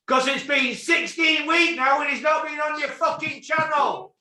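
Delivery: high-pitched, furious rant; angry venting. Real, not acted. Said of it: "Just vent it out".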